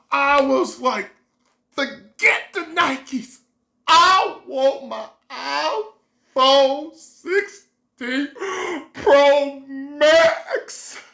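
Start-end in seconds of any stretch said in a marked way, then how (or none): 1.7-11.0 slow, drawn-out, starry-eyed; dreamy longing. Retold or imitated. Dropping the Nikes for the phone, slow dreamy longing. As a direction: savour each word like a wish. Choosing the phone over shoes.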